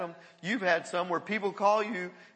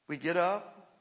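ordinary talk, nothing marked

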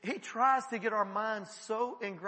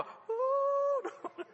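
high, long drawn-out "ooh"; mock-eerie howl held on one high note; spooky wail. Preacher mimicking someone possessed, spooky wail.

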